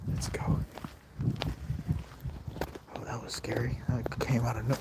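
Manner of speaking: soft, low and secretive, partly whispered; hushed. Real, not acted. Vlogger creeping to the car after gunshots, hushed. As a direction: keep it down so nobody hears you.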